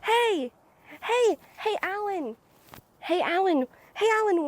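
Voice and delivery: high-pitched calls to get his attention; calling out. Retold or imitated. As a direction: re-enact calling repeatedly into an unheard phone. Calling to Alan through the phone.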